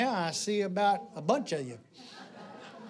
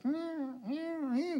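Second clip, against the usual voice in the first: high, soft drawn meows; imitated cat meow; 10 semitones higher, 5 dB quieter, much slower than usual. Vet's cat circles the dead dog, imitated meowing. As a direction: mimic a cat mewing. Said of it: "cat goes".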